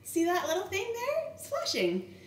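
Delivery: high, bright pointing-out voice; cheerful sonographer. Retold or imitated. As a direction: point it out happily to the worried mother.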